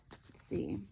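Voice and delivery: breathy whisper to herself while looking at cards; barely voiced, just for herself; whispered aside. Real, not acted. Tarot reader whispering to herself as she studies the cards.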